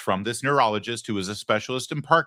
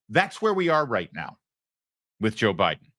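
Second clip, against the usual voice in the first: ordinary talk, nothing marked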